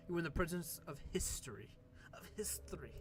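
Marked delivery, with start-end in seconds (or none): 2.1-2.9 hushed, whispered reverence; soft, voiceless stage whisper; whispered echo. Acted. Whispered echo of the grand line.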